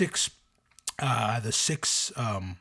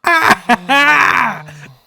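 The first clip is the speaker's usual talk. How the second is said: loud laugh; 9 semitones higher, 13 dB louder than usual. Real, not acted. Bursts out laughing.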